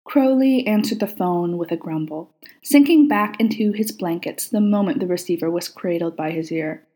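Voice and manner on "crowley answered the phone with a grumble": ordinary delivery, nothing marked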